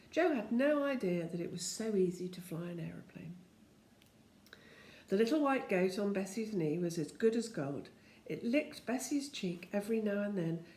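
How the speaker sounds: usual speaking voice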